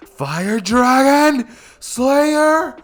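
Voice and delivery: very high, loud, long-stretched attack yell; battle-cry roar. Acted. Naruto unleashing his fire attack on bullies, roaring battle cry. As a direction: yell out the attack name slowly, building into a roar. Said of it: "he would do that little roar thing".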